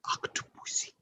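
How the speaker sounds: "Octopussy" whispered, savoured. Retold or imitated. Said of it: "made that sound fly".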